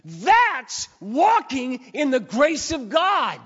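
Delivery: high, loud, impassioned pulpit confession; fervent preacher on a roll; full-force preaching. Real, not acted.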